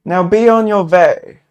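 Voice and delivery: robed man threatening the intruder with a staff; exclaiming. Acted. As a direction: drive them off with a shout. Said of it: "another exclaims".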